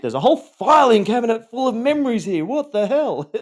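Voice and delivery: high, loud, altered voice of alarm; stunned disbelief. Retold or imitated. Victim recalling buried abuse, high stunned disbelief. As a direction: sound floored by what you just found. Realising trauma memories exist.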